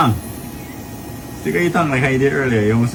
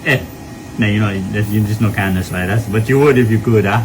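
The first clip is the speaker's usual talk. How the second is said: ordinary delivery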